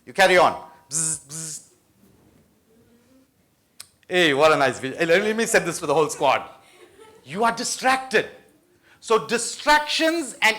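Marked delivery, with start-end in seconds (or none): 0.9-1.6 soft buzzing hum like a phone on the desk; a quiet imitated vibration, lips buzzing; mock phone buzz. Speaker imitates a phone vibrating during study, soft buzz. 4.1-6.5 lightly voiced student, a bit quick; a casual aside, like someone who has just found a nice video; distracted student. Student hooked by a video on the phone, light casual voice.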